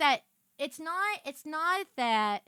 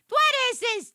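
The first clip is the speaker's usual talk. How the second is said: higher, louder outraged question; disbelieving shout; 11 semitones higher, 7 dB louder than usual. Real, not acted. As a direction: yell it in disbelief. Escalating disbelief.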